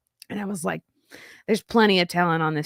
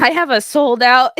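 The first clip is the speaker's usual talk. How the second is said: ordinary talk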